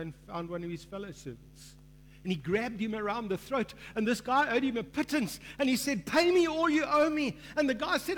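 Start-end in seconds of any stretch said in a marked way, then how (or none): 6.1-7.4 loud, high, hammed-up demand for the debt; harsh demand. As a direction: bark it at the poor debtor. Servant throttling his debtor.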